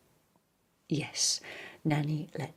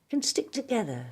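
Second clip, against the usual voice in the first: higher, warm bear; Pooh's voice; 8 semitones higher, 5 dB louder than usual. Acted. Pooh telling the boy two stick together, higher. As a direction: lift it as Pooh's happy line.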